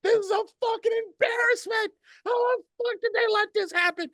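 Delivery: very high, strained yelling in rage; furious yell. Retold or imitated. Fan screaming in his car at the announcement, very high yell. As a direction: scream it at the steering wheel. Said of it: "screaming and yelling".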